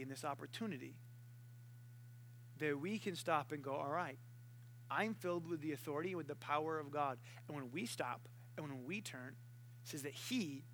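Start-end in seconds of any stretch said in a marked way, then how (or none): 3.7-7.2 calm resolve. Acted.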